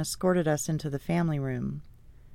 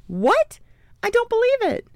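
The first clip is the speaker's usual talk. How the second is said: very high, loud squeal of amazement; disbelieving shout; 8 dB louder than usual. A narrator's reading. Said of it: "she shouted".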